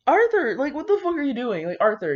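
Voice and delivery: ordinary delivery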